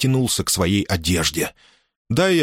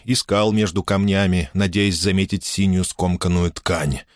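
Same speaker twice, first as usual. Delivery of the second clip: usual speaking voice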